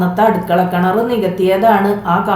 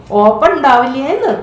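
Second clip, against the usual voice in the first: higher, louder repeated question; 6 semitones higher than usual. A narrator's reading. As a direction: repeat it louder for the deaf man. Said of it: "ഒന്നുകൂടി ഉച്ചത്തിൽ വലിയമ്മ ചോദിച്ചു". (ഒന്നുകൂടി ഉച്ചത്തിൽ ചോദിക്കുന്നു)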